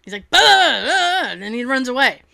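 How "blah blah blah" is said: higher, loud babbled blahs; animated Gordon. Retold or imitated. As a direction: imitate an over-animated chef bursting out. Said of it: "he's very animated".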